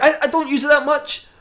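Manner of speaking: high, stammered denial; defensive excuse. Acted. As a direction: squeak out a guilty excuse. Batman makes defensive excuse.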